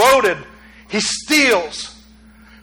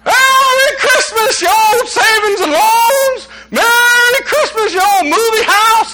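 high, drawn-out holler of greeting; joyful yelling; 12 semitones higher, 3 dB louder, slower than usual. Acted. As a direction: yell it down the street, overjoyed. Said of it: "running through the town yelling".

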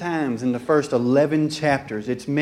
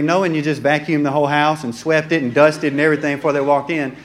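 usual speaking voice

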